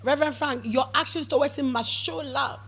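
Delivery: loud, driving pulpit delivery; full-force sermon voice; fervent preaching. Real, not acted. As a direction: preach it hard to the church.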